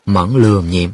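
plain reading voice

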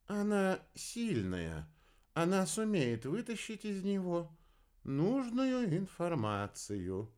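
high, very slow character voice; dreamy, high-pitched astrologer. A narrator's reading. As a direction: stretch it out high and unhurried.

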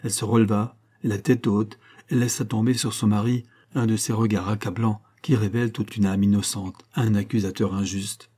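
ordinary delivery

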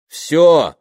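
loud, high drawn-out shout; loud call. Acted. Man calls to the woman in the hallway, loud. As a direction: call it out loudly. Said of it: "громко сказал он".

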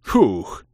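loud glad sigh of relief; relieved exhale. A narrator's reading. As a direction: blow out a relieved "фух". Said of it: "обрадованно выдохнул я". (облегчённый выдох)

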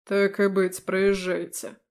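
stern officer, grudgingly lenient; firm older man giving way; commander voice. Acted.

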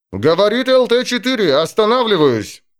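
pilot on the radio, relieved. Acted. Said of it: "в его голосе прозвучало облегчение".

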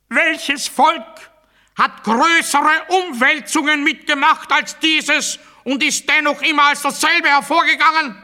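high, loud rally-speech voice, slowing on the first line; patriotic oratory. Acted. Speaker rousing the crowd with a patriotic question, in loud high oratory. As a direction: proclaim it like a demagogue to the crowd.

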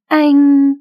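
one word thrown out in frustration; indignant cry. Acted. Hân cut short, speechless.

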